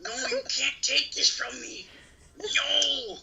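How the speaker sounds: high, slow melodramatic wail; mock anguish. Child actor losing the role, melodramatic despair. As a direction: wail it like a hammed-up tragedy.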